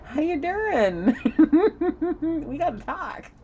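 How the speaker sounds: high, slow, laughing mock greeting; the ex showing up. The dragon ex greeting the soulmate, in a high laughing mock voice. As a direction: put on the dragon ex's sweet-menacing hello. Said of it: "and, well, there she is".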